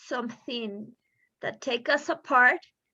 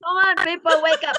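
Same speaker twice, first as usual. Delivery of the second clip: louder, higher call to wake up; overexcited; 5 semitones higher, 8 dB louder, faster than usual. Real, not acted. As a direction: urge the listeners, exasperated. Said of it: "I get overexcited talking about this".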